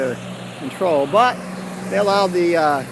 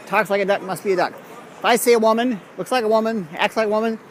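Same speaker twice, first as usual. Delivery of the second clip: ordinary talk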